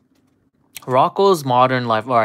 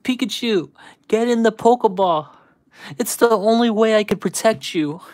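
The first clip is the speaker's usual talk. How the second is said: higher, pleading cartoon hero voice; imitating Ash; 7 semitones higher than usual. Streamer retelling Ash's plea to Pikachu, high pitched. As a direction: plead like the young trainer. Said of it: "Ash was like".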